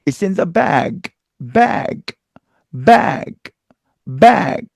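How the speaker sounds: bag with a released g; linked slowly; English bag. Shown as a demonstration. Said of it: "이렇게 발음하죠".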